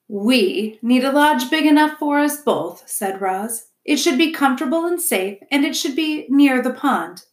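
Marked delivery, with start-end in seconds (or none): 0.1-2.9 higher, louder robot voice; projected robot giving requirements; earnest robot. A narrator's reading. 3.8-7.3 higher-pitched robot voice; robot spelling out its needs, raised pitch; earnest robot. Acted. Robot lists requirements.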